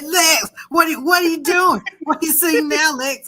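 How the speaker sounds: high, loud mock shouting; hammed-up frantic yelling at Lex; overeager cops. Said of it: "They're like".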